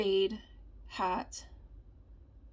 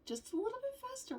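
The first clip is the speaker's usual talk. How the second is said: much higher and softer, teasing the class to speed up; playful squeak; 13 semitones higher, 4 dB quieter than usual. Shown as a demonstration. Teacher nudging sleepy students to go faster, in a high teasing squeak. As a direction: squeak it up high, egging them on. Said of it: "I thought I saw you yawning".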